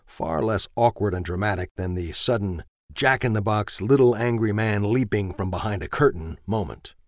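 plain reading voice

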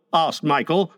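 plain reading voice

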